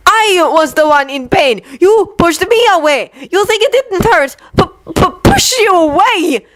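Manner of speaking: high, stuttering angry cry; hurt outburst. Acted. Victor tells Yuri how much it hurt, angry. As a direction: choke on the words in anger.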